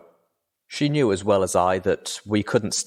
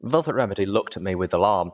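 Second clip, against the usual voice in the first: ordinary delivery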